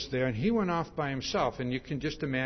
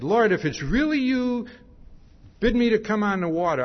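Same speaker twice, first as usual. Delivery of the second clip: eager call; 7 semitones higher, 6 dB louder than usual. Retold or imitated. Peter eagerly calling to Jesus.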